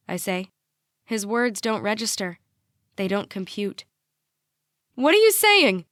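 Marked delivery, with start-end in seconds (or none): 5.0-5.9 blurted out much higher and louder; high-pitched blurt. A narrator's reading. Mom blurts at dad in disbelief, high-pitched. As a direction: burst out in one high, tangled pitch. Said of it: "her words tangled into one high pitch".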